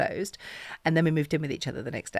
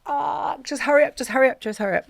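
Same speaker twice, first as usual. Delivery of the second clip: high, loud, rushed hurry up; chivvying herself along in a panic; frantic self-urging; 10 semitones higher, 6 dB louder than usual. Retold or imitated. To herself, late for meeting Chip, frantic urging.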